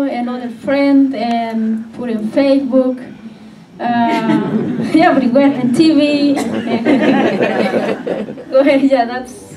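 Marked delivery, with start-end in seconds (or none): none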